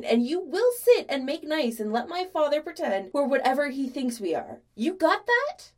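loud, high, heated tirade; rising rant. A narrator's reading. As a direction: build into an insistent, bossy outburst. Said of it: "picked up both speed and volume".

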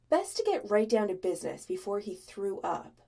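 usual speaking voice

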